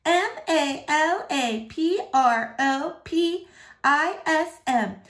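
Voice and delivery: high, slow letter-by-letter spelling; teacher's pet spelling the word to impress. Acted. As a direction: show off every letter. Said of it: "starts spelling it".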